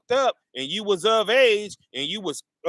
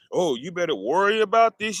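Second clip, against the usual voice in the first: ordinary delivery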